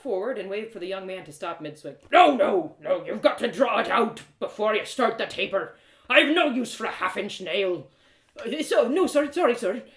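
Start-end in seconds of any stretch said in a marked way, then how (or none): 2.1-7.9 put-on loud, rough old smith barking at his apprentice; a burly smith losing patience, loud; gruff blacksmith, scolding. Voices gruff blacksmith Matty. 8.4-9.8 put-on higher, flustered young lad stammering apologies; a jittery boy caught out, higher and loud; nervous apprentice.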